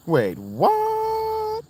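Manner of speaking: sudden very high startled squeak; squeaky surprise. Real, not acted. Caught off guard by chat, high squeak. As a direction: jump way up in pitch, caught off guard.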